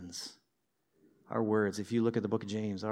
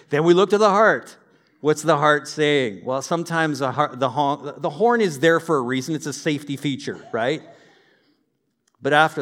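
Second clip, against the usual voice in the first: loud; 3 semitones higher, 11 dB louder than usual. Acted. Energetic point after the joke.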